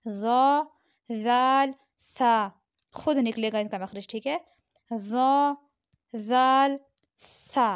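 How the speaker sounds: ज़ा ज़ाल सा each said with the tongue tip between the teeth; a careful soft-letter drill. Shown as a demonstration. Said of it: "दरमियान में सिर्फ रखना है और आवाज़ निकालनी है".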